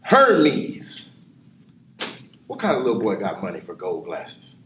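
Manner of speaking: normal talking voice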